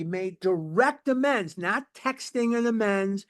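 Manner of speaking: normal talking voice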